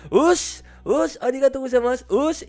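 high, loud yelled chant; shouted call of us and arigato gozaimasu; mock shout at full force. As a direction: yell it behind the temple like a kid. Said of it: "empezamos a gritar con todas nuestras fuerzas".

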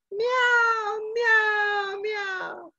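high, mewing cat imitation, fading off; cat meowing. Preacher imitating the prison cat's high meow. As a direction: mew like a small cat outside the cell.

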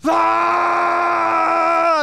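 high, loud imitated stadium roar; imitating the stadium erupting after a touchdown; crowd roar. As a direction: let out a whole arena's cheer. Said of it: "all you hear all around the arena is like".

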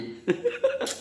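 very high breathy squeal laugh. Real, not acted. Laughing at the friend's face.

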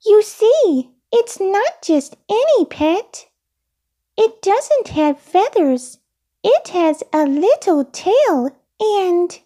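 high-pitched puppet voice; very high childlike character voice; Elmo, explaining. A narrator's reading. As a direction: explain earnestly in a small high voice.

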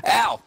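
loud, high "ow"; pained yelp. Real, not acted. Player yelps mid game, pained yelp. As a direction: let out a sudden sharp yelp.

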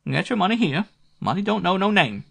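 a small-time dick squeaking out his price; shrill private eye. A narrator's reading. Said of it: "This dick had a shrill voice".

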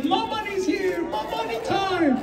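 high, soft sing-song grandma voice; chirpy old Persian grandma arriving. Retold or imitated. Grandma making an entrance, high and sing-song. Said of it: "Mamani comes in like".

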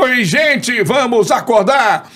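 high, drawn-out radio host wake-up call; Antônio Carlos impression. Radio host greeting listeners to wake up, high-pitched impression. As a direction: sing out a stretched morning greeting on air. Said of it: "Eu conheci o Antônio Carlos".

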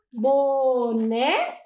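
Boneca slowly, bo-ne-ca beat by beat; split into syllables. Shown as a demonstration. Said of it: "Uma, duas, três". (Boneca devagar, sílaba por sílaba)

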